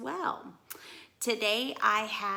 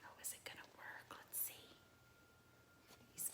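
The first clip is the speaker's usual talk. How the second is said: hushed suspenseful whisper; 23 dB quieter, much faster than usual. Real, not acted. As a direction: whisper as if holding your breath.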